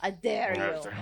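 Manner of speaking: loud, rough monster taunt repeated. Acted. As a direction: growl it again like a beast. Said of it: "it's my monster voice".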